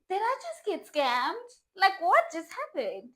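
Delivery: slow, high, loud incredulity; stunned outburst with stretched-out words; shocked disbelief. Retold or imitated. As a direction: demand an answer, loud and drawn out. Acted shocked reaction to being blocked.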